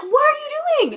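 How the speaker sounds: high, loud panicked question; high mock alarm. The girls panicking at the old lady buzzing Kevin in, high and loud. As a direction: squeal in alarm at her.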